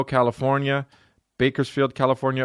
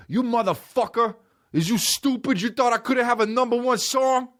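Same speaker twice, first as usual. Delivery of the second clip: high-pitched angry rapper impression; mock an outraged rapper in a very high voice; 12 semitones higher than usual. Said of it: "why the fuck are you so angry".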